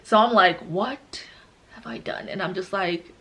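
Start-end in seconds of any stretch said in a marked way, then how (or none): 0.6-2.3 very soft, halting mock regret; dramatic hush. Replaying her shock in the pool, hushed drama. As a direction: pause after WHAT, then nearly swallow the rest. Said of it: "I'm being dramatic".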